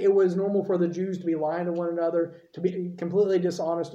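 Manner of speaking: ordinary delivery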